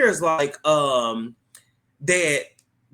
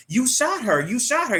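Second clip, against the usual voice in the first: higher, shocked repeated cry; shocked accusation; 9 semitones higher than usual. Retold or imitated. Marissa to Peter after he shoots Josie, shocked high accusation. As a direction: blurt it out in disbelief.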